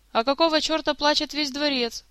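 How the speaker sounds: grumbling. A narrator's reading. Crier retorting to the jester's question, grumbling. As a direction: throw the question back, mildly grumpy. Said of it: "проворчал мистер Лиц".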